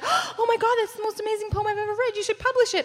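high gushing mom voice; excited mom. Retold or imitated. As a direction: gush like a proud mother. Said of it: "she was like".

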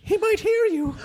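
high, laughing fussy lady voice; high worried woman whispering a warning; Emily impression. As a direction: squeak it out high in a panicky hush. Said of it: "love your Emily impression".

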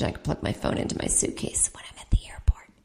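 hushed, unvoiced sales plug; whispered aside. Comic slipping in a luggage advertisement, whispered aside. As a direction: drop into a whisper.

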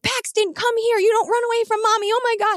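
high, fast, frantic panic calling; a mother who just lost her toddler in a crowd; hysterical mom. Retold or imitated. Mom calling her runaway son at the gate, high frantic panic. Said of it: "And I'm hysterical".

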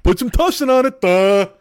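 loud, high, slow dim-witted drawl; mock idiot boss voice; dopey Trump impression. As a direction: ham it up like a dull-witted blowhard. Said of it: "the fucking Wookiee that runs this fucking country is just like".

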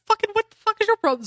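high, fast indignant outburst; mock angry yell. Comic-book kid griping at Superman, high mock yell. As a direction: act out a kid telling off Superman. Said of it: "he yells at Superman".

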